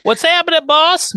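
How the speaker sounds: very high, loud squeal; over-the-top chirpy hello; hammed-up greeting. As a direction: belt it out in silly falsetto. Hammed-up repeat of Lily's line.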